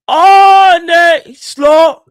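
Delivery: high, loud drawn-out name; mocking shout. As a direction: throw the co-host's chant back at him. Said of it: "That's what you said".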